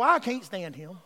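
ordinary talk, nothing marked

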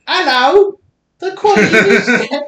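high, loud mock Chinese accent. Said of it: "This is my Chinese accent".

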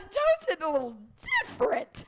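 high, loud echo of a joke; delighted outburst. Real, not acted. Echoing a friend's Toad joke, loud and delighted. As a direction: burst it out, punching the last word.

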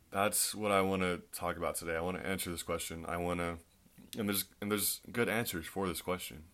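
usual speaking voice

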